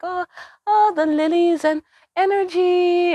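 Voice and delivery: high, slow, sugary imitation of the teacher; sweet little voice. Imitating the gentle teacher's sweet voice. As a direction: float it up high and sweet, drawn out. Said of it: "in her little sweet ass voice".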